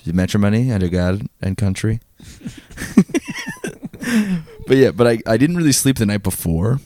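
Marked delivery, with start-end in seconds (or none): 2.8-4.6 high laugh. Real, not acted. Laughs at own joke.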